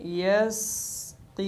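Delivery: यस्की drawn out slowly; waqf form. Shown as a demonstration. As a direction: recite यस्की in pause. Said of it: "ऐसे ही पढ़ेंगे".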